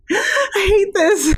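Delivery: horrified. Real, not acted. Player horrified by eyes.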